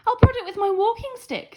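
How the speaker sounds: put-on high, loud old-timer voice; old guest voice. A narrator's reading. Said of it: "said the oldest guest".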